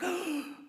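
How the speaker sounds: a quick imitated gasp of surprise; mock shocked gasp. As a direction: gasp as if startled. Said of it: "everybody's like".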